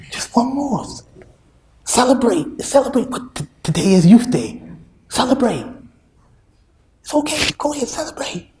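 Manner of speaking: high sly tempter voice; tempting devil voice. Retold or imitated. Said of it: "He whispers".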